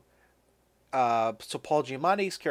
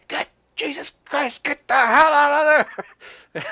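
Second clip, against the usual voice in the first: high, drawn-out hammed-up yell, swelling loud; mock scream; 13 semitones higher, 9 dB louder than usual. Scientist screaming at colleague on the dam, hammed-up. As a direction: imitate screaming across at someone in danger. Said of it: "like, screaming to him".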